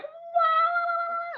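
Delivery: high nasal harmonica squeal imitated with the voice; harmonica wail. Asked for a harmonica accent, he wails like one. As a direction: mimic a harmonica note. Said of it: "do a harmonica accent".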